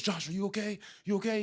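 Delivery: softly voiced worried mom; a hint of a concerned mother checking on her child; mom mode. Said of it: "she goes into mom mode".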